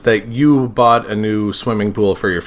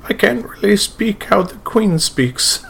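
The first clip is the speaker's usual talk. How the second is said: posh Queen's English, higher and slow; slow, lilting Queen's English; proper English accent; 11 semitones higher, slower than usual. Shown as a demonstration. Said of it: "Like a proper".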